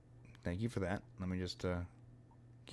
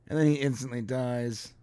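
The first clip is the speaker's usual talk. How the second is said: normal talking voice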